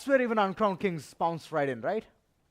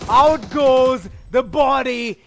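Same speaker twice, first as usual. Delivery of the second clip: esports caster; 5 semitones higher, 6 dB louder than usual. Real, not acted. Caster calls a squad wipe.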